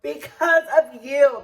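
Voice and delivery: slow, loud, hammered-out accusation; re-enacted yelling. Server with knife blames coworker, yelling angrily. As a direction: yell it word by word, drawn out. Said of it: "that's why I'm yelling".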